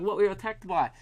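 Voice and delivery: high mock Kiwi radio host; New Zealand accent. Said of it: "I've got it now".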